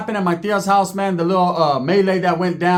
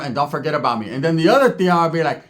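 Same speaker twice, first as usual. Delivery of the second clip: ordinary talk, nothing marked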